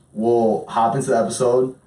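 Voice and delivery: ordinary talk, nothing marked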